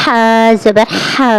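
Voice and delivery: airy ح in ہا, breath pushed through the throat; breathy ح. Shown as a demonstration. Teacher showing the airy throat ح. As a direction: push air through the ح of ہا. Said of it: "اس کی آواز نکلنی چاہیے ہوا کے ساتھ".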